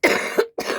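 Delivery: short tickly dry cough. Real, not acted. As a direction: cough from a cold, quick and dry. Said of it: "a little bit of a tickly cough".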